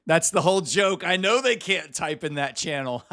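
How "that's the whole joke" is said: ordinary delivery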